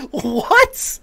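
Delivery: can't believe the number; shocked disbelief. Real, not acted. Shocked at the fine.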